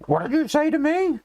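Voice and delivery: put-on teen hero answering the chicken taunt, high and offended; indignant Marty. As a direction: play Michael J. Fox's hero rising to the bait, voice shooting up. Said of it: "And he's like".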